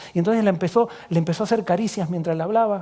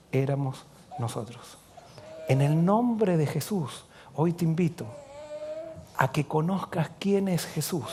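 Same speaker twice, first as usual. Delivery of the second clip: soft closing altar call; 5 semitones lower, 9 dB quieter than usual. Acted. Closing appeal of sermon.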